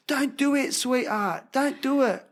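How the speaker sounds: lightly voiced cockney-ish father; geezer dad. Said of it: "geezer accent".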